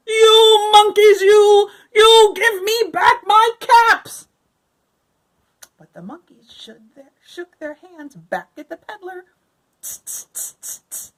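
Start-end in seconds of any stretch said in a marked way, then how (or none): 0.1-4.3 high, loud and slow, furious; angry peddler. A narrator's reading. Peddler yelling up at the monkeys in the tree, angry. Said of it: "This made the peddler very angry". 9.8-11.1 soft, breathy tutting; monkey tsk-tsk. A narrator's reading. Monkeys tutting back at the peddler, teasing.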